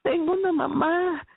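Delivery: mild, lifted cry of joy; retold tearful joy. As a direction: relive a joyful cry, without raising volume. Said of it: "comencé a llorar".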